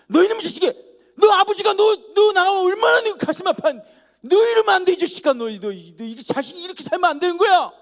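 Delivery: furious yelling at a runaway brother; scolding shout. Acted. Big brother shouting at his runaway younger brother, furious. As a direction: roar at him like an angry big brother.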